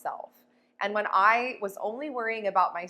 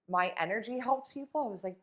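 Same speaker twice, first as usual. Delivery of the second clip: ordinary delivery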